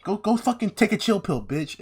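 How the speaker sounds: mocking dismissal. Sokka chases Aang off, high and scornful. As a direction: run him off scornfully. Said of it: "He's like".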